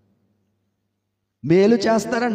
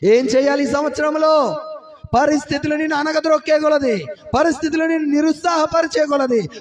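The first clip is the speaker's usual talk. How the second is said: raised preaching; 7 semitones higher than usual. Acted. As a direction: lift it up for the church. Repeat preached louder.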